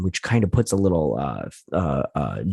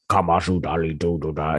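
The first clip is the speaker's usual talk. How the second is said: possessed; 2 semitones lower than usual. Retold or imitated. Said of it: "all possessed and everything".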